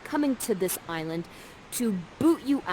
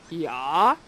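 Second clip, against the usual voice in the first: lower, questioning yeah; dopey Ferdinand; 4 semitones lower than usual. Acted. Ferdinand answering Miranda's call, low puzzled yeah. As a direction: answer like a distracted boy. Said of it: "And Ferdinand says".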